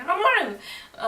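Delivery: loud, high-pitched protest; hammed-up high outburst; mock wailed refusal. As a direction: wail it like an overwrought refusal. Acts woman's upset refusal.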